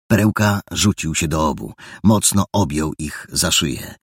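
plain reading voice

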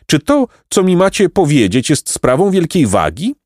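inquisitive pompous donkey. A narrator's reading. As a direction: ask with self-important curiosity.

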